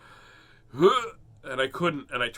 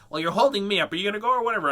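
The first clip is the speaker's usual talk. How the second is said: put-on higher, louder bossy little girl; imitating a pushy little girl; impatient girl; 7 semitones higher, 6 dB louder, much faster than usual. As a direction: nag like a kid in a queue. Girl voiced again.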